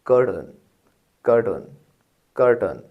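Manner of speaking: "कर्टन" as "kur-tn", short reduced end; correct pronunciation. Shown as a demonstration. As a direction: repeat "कर्टन" firmly with a weak last syllable. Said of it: "उहुं।".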